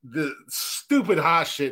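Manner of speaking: ordinary talk, nothing marked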